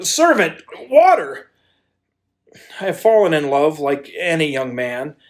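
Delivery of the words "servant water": high, loud call for the servant. Acted. Dazed man calling loudly to the servant for water. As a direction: call out for help, dizzy with love.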